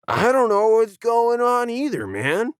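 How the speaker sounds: high mellow surfer-dude voice; laid-back dude. Acted. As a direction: shrug it off, easygoing. Leaf, laid-back friend.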